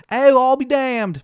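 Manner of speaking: loud, high, drawn-out Maryland twang; Baba Yaga. Acted. Baba Yaga looking over your shoulder, loud terrible Maryland accent. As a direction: stretch it out in a bad Maryland accent. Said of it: "Accent's terrible".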